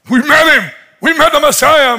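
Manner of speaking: high, loud breaking news; all excited. Retold or imitated. Phil running up to Nate, all excited. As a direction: run up bursting with the news. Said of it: "he's all excited".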